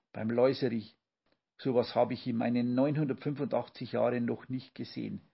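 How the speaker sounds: plain reading voice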